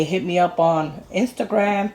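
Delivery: ordinary delivery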